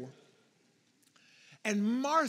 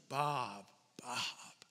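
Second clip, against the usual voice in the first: softer, lower tender double call; caring; 7 semitones lower, 6 dB quieter than usual. Shown as a demonstration. Speaker demonstrating a caring double call of Bob, softer and lower. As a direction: say the name twice with care. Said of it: "it's a word of care".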